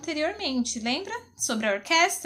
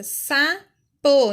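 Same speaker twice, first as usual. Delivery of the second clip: "sapo" as sa, pause, po, pushed louder; split into syllables; 7 dB louder than usual. Shown as a demonstration. Teacher showing sa on top and po below, "sapo" split by syllable. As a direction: separate "sapo" into two distinct beats. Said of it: "sa ficou em cima e o pô embaixo".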